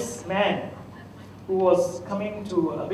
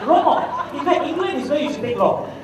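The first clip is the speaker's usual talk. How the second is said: laughing; 6 semitones higher, 4 dB louder than usual. Retold or imitated.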